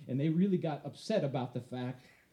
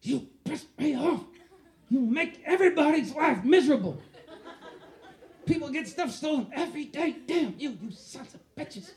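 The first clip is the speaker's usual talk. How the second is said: raised pitch, scolding rant; telling off the thieves, heated; angry cursing; 8 semitones higher than usual. Retold or imitated. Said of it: "I was just cursing at them".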